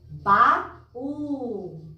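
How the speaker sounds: "Baú" split in two, the ú trailing long; stretched stressed vowel. Shown as a demonstration. Teacher shows children the sound going on, stretching "Baú". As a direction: separate "Baú" and let the ú carry on. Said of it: "ele vai-se embora".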